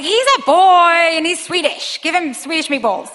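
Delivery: insistent husband. Retold or imitated. Husband standing up for hungry son to wife, insistent protest.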